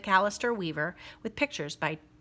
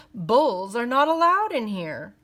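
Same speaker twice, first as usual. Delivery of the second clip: louder, sniffy repeat of the rule; sneering; 5 semitones higher, 8 dB louder than usual. Acted. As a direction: sneer it at him again. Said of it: "the clerk repeated with a sneer".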